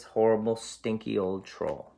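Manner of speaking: plain reading voice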